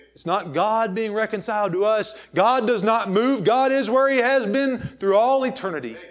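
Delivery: loud, high preacher's cry; fervent pulpit proclamation; preaching at full force. Acted. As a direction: lift the congregation with it. Preacher builds to a peak.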